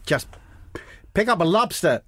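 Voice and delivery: put-on high-pitched, off-target American accent; botched American-sounding accent. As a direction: attempt an accent that slips into American.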